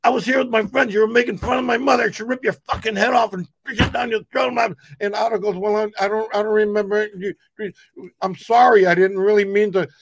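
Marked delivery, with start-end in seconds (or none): 0.0-4.6 imitating an enraged Brooklyn guy; furious shout. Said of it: "He goes". 5.6-9.9 timid apology. Retold or imitated. Shy Otto apologizing.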